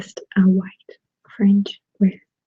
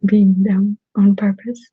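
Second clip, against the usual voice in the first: ASMR whisper. Real, not acted. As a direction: whisper close to the mic.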